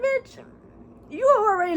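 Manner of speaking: squealed bitch, way up high; playful, high-pitched scolding; high-pitched mock bitch. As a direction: call her out in a high squeal. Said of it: "And I'm just like".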